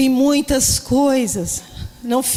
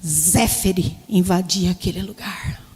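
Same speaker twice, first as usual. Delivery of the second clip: reverent reading; 3 semitones lower, slower than usual. Retold or imitated. Reads meaning found reverently.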